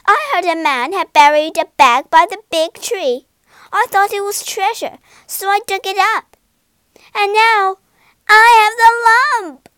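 loud high ranting, building to a yell; furious rant. A narrator's reading. Wife rants at Egbert about the lump, building to a yell.

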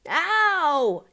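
sharp high yelp of pain; loud pained howl; scream. A narrator's reading. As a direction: cry out as the hat is yanked. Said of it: "he screamed".

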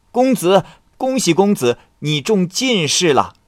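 high, loud herald calling out good news; eager servant shouting the news; excited announcement. Acted. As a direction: burst in crying the good tidings. Another messenger announces exam success.

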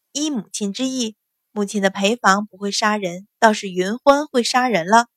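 ordinary delivery, nothing marked

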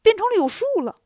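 high, panicky stammer; scared novice blurting bad news; startled, frightened. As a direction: stammer it out to your master in a high, loud fright. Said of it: "吓了一跳".

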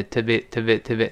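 "to be" run together quickly, unstressed; quick, skimmed over. Shown as a demonstration. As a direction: rattle off a reduced "to be", barely distinct. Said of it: "一带儿过听不清了啊".

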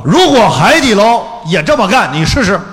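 rising excitement on a rhetorical dare; emphatic challenge. Real, not acted. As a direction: throw down the challenge with energy. Challenging the audience.

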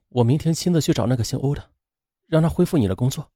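soft speech. A narrator's reading. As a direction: speak softly, keeping it gentle. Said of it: "王月轻声说". (轻声说)

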